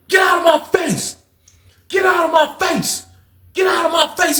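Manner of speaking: high, indignant brush-off; angry retort. Retold or imitated. Skate telling the man screaming at him to back off, angry retort.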